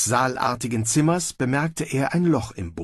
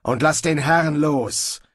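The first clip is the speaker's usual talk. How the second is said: louder, slowed order; called command; 5 semitones higher, 3 dB louder, slower than usual. A narrator's reading. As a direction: demand it firmly and deliberately. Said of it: "rief John O'Reilly". (befehlend gerufen)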